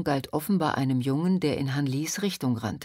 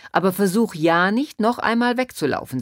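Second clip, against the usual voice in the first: louder, rising, insistent order; stern warning; 4 semitones higher, 6 dB louder than usual. A narrator's reading. Policeman warning Tang Ming not to flee, stern. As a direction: warn firmly against disobeying.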